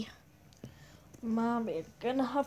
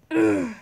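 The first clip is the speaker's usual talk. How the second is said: loud frustrated groan; 3 semitones higher, 14 dB louder than usual. Acted. Exasperated groan.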